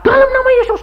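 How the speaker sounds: very high panicked prayer cry; hammed-up, squealed invocation; mock frantic praying. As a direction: imitate someone making a premature fuss in prayer. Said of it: "kok sudah ribut kayak gitu".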